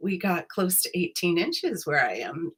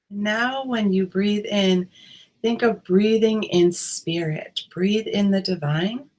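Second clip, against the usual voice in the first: guided meditation. Acted. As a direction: ease listeners in, unhurried.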